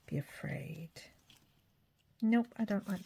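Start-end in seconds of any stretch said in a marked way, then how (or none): 0.1-0.9 spooky whisper. Pretend. Reading a spooky word label.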